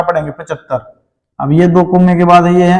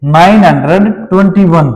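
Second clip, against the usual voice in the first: normal talking voice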